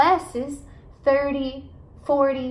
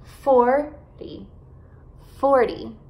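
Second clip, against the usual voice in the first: FORTY with a strong FOR, light ending; FOR-dy, first syllable stressed in FORTY; much slower than usual. Shown as a demonstration. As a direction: hit the start of FORTY. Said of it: "enfatizar as sílabas no começo da palavra".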